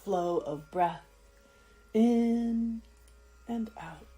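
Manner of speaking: slow, calm yoga nidra voice with long pauses; soothing, unhurried guide; guided meditation. Acted. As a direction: let each word settle, drawn out and gentle. Guiding attention to breath.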